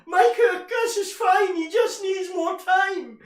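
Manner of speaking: hammed-up fan shouting in his team's defence; Ty from Arsenal Fan TV. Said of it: "it would be Ty ... arguing".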